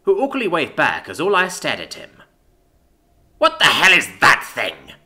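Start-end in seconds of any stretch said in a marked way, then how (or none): 3.4-4.9 slow, loud, scornful demand; disgusted snarl. A narrator's reading. Isadora demands to know about the human, snarled. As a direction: snarl it with contempt. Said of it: "Isadora snarled".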